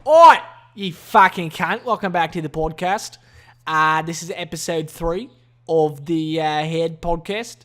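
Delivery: loud, hammed-up Aussie podcast host; brash, amateur Australian accent. Shown as a demonstration. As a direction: open with a big Australian "Oi". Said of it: "I can do an Australian accent pretty good".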